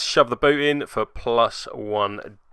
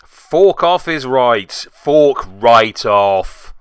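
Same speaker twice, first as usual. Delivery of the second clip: slow, louder, exaggerated grumble; mock disgust; 7 dB louder, much slower than usual. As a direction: drag it out like a sulky curse. Mock curse at countercharging Tater.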